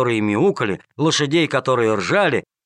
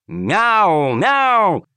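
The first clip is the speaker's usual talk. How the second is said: rising, louder meows like a pouncing cat; 8 semitones higher, 3 dB louder than usual. A narrator's reading. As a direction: meow as you pounce. Man-cat pounces on journalists.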